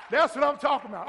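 normal talking voice

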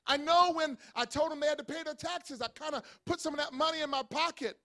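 usual speaking voice

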